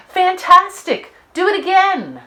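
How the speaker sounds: shouting. Acted. Princess cheering the dragon on, shouting. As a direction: egg him on at full voice. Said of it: "but Elizabeth shouted".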